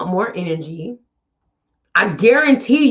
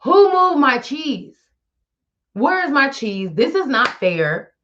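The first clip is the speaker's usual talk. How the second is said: mock whine; 5 semitones higher than usual. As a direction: whine like a sulking child. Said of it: "you're like".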